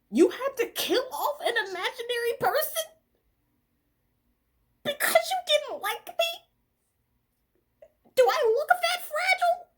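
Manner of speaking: high indignant mock squeal; incredulous outrage. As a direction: squeal the question at him. Addressing him in outrage.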